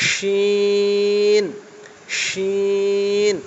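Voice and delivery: শিন with a long hushing sh from the middle of the tongue; thick sh sound. Shown as a demonstration. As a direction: push the air out through the middle of the tongue on শিন. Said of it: "জিভের মাঝখান থেকে হাওয়াটা বার হবে".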